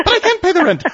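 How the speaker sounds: high, wailing damsel voice; damsel in distress. Retold or imitated. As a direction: squeal it like a helpless heroine. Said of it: "it's an old melodrama".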